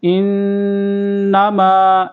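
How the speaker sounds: إِنَّمَا with the doubled n held in the nose, slow; nasal ghunna on inna. Shown as a demonstration. Teacher demonstrates ghunna on إِنَّمَا, holding the nasal n. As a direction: hum the nn in إِنَّمَا. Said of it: "ایسے غنہ کریں گے۔".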